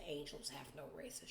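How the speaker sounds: quoting a friend in a soft whisper. Retold or imitated. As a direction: breathe it out as a secret whisper. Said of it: "And he said".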